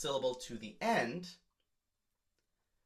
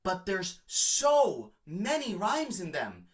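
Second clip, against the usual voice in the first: awed; 4 semitones higher, 3 dB louder, slower than usual. Real, not acted. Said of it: "gushing over the lyrics".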